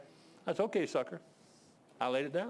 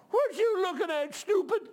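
high-pitched indignant holler; high mock-angry shout; imitated sister's yell; 14 semitones higher, 4 dB louder than usual. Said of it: "she yelled out".

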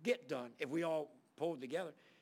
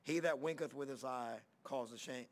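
low, measured Bible reading voice; scripture reading; 3 semitones lower than usual. Acted. Reads scripture.

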